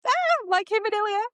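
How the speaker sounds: high, fast excited squeal; a burst of fangirl delight; squealing aside. Real, not acted. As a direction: gush about the couple in a sudden high voice. Gushing aside about the pair.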